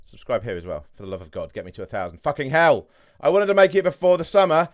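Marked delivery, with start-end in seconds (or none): 2.2-4.7 loud plea climbing higher and louder; exasperated pleading. Real, not acted. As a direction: beg viewers, raising your voice as you go. Begs viewers to subscribe.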